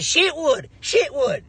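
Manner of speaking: high Shitwood, Shitwood in a Nigerian voice; mimicking David. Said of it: "he kept saying".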